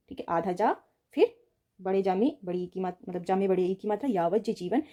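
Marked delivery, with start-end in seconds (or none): none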